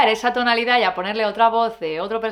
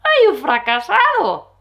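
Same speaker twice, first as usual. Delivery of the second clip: higher, louder and drawn out; loud slow mock wail; 7 semitones higher, 7 dB louder, slower than usual. Shown as a demonstration. Hamming up the negative thought. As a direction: wail it slowly in mock despair. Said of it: "Para empezar a hablar así".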